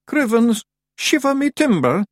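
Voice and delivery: very high, loud exclamation; shout. A narrator's reading. A voice bursting in behind Tiffany, shouting. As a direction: yell it out in a high character voice. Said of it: "shouted a voice behind her".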